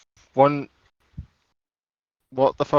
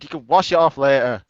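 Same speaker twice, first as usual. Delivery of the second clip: usual speaking voice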